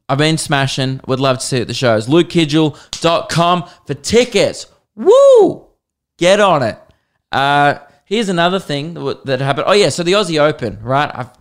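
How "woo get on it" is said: a loud, high, joyful whoop; excited whoop. Real, not acted. Comedian hyping tour tickets, excited whoop. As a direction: cheer like you're hyping the crowd.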